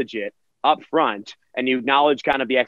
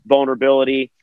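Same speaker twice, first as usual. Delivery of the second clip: ordinary talk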